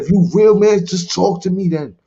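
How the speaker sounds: high, loud challenge; daring God to answer him; angry dare. Retold or imitated. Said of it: "I'm mad at God".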